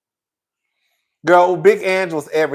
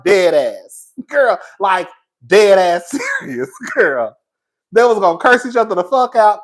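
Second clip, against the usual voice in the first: ordinary talk, nothing marked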